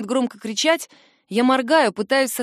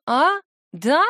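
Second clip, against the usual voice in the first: tipsy, high silly question; drunk; 4 semitones higher than usual. A narrator's reading. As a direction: ask it dumbly, tipsy. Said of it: "Глупо переспрашиваю я".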